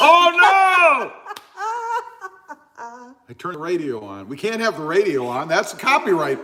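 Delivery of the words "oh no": high, loud startled cry; surprised shout. Real, not acted. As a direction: blurt it out in sudden alarm. Radio suddenly turned on.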